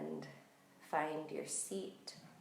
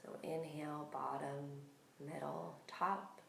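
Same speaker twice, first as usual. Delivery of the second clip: calm, unhurried meditation voice with long pauses; guided meditation; 5 dB quieter than usual. Real, not acted. Yoga teacher settling listeners into breath awareness, calm guided meditation.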